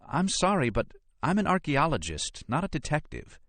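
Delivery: high, irritated woman's character voice; Nora objecting. Acted. Irritated archaeologist refuses detective work, high protesting voice.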